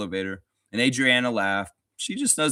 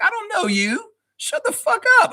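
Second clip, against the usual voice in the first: very high, exaggerated squawk, getting louder; hammed-up outburst building to a loud, high shout; retold telling-off in a squeaky high voice; mock angry retort; 6 dB louder than usual. Retells snapping at the lady.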